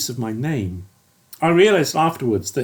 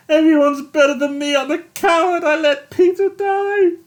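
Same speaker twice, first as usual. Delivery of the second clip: high, squeaky tearful pleading; a broken man apologising to Holmes; pitiful squealing sobs; whimpering apology; 13 semitones higher than usual. A narrator's reading. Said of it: "and Yates squealed".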